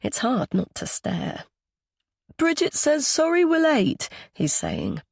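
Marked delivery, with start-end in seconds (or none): 2.4-4.1 put-on high-pitched child passing on a message; little boy. A narrator's reading. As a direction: report it like a small boy. Young boy relays a message.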